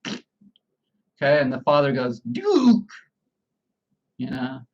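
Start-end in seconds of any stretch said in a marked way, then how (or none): 2.2-2.9 high, loud call to a dog; father's first sharp scolding shout. Acted.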